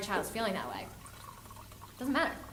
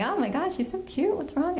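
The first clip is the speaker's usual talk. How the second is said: higher, lightly gushing reaction; cooing concern; 7 semitones higher, 4 dB louder than usual. Retold or imitated. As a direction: coo with mild mock concern. Retold gushing first reaction.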